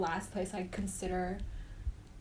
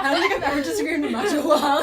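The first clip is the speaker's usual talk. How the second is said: laughing joke; 7 semitones higher, 12 dB louder, faster than usual. Real, not acted. Laughing joke about anthem.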